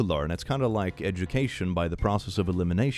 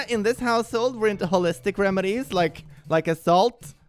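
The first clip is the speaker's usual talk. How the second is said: high, mocking holistic mom voice; a snide parody of a crunchy mom; mock snooty mom; 9 semitones higher than usual.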